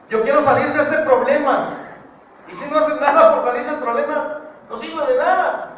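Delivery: high, loud preacher's cry; full-force preaching. Real, not acted. Preacher warning those who only wish to escape trouble, preaching at full force. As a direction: ring it out high over the congregation.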